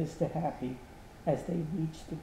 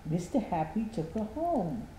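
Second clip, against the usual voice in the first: ordinary delivery, nothing marked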